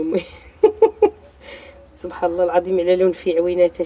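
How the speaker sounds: usual speaking voice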